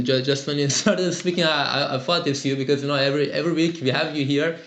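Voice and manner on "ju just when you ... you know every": ordinary talk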